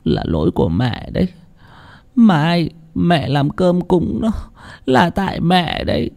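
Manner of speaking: mother weeping by her son's side; tearful confession. A narrator's reading. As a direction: plead through tears, blaming herself. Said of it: "mà khóc".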